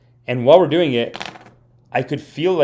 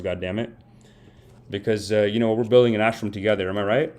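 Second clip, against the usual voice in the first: usual speaking voice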